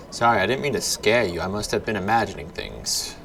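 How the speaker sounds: ordinary talk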